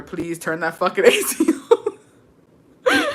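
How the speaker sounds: slow, pleading line that breaks into laughter; laughing plea. Retold or imitated. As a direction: beg through giggles. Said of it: "while I was telling my brother".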